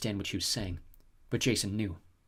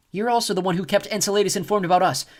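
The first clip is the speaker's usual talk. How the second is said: higher, louder accusation; accusing Piper; 9 semitones higher, 8 dB louder than usual. A narrator's reading. Piper confronting the snow goddess, loud accusation. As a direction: call her out sharply.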